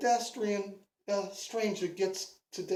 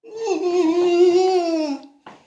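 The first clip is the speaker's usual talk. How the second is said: big loud real cackle; loud laughing burst; 8 semitones higher, 11 dB louder than usual. Real, not acted. Teller cracks up at his joke.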